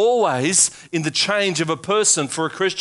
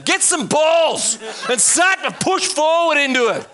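laughing exhortation; 8 semitones higher than usual. Acted.

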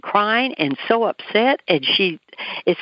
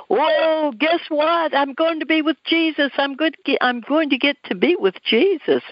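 high, elated retold exclamation; joyful praise; 9 semitones higher than usual. Dying woman rejoicing that she's going to Jesus, joyful praise. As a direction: imitate her rejoicing in a raised, sustained pitch. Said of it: "she began to praise God".

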